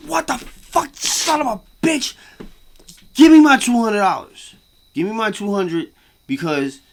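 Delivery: screaming at the lucky winner; furious yell. Acted. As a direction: demand the money back, yelling. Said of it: "I would be fucking furious".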